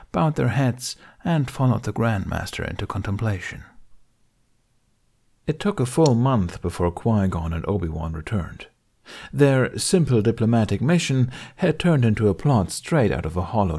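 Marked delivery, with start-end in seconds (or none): none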